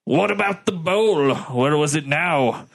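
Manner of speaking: accidental drift into Scottish; lightly Scottish-accented question; weird Scottish accent. Acted. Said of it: "going into a weird Scottish accent".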